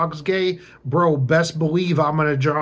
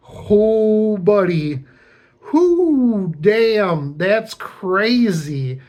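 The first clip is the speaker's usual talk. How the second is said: mock shock; 5 semitones higher, 5 dB louder, much slower than usual. Reacting in his head to coworker.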